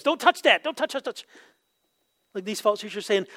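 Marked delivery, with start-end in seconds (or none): 0.0-1.2 high, rapid mock warning; parent warning child. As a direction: fuss like a parent at a toddler near the stove. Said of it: "can't trust kids around ovens and stoves".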